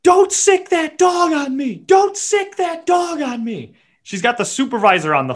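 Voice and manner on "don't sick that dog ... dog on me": high, slow shrieking woman; imitating a shrill panicked scream; mock scream.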